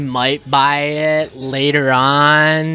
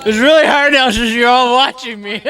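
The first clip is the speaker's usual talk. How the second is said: laughing; 6 semitones higher, 4 dB louder than usual. Real, not acted. Chewing, laughing while watched.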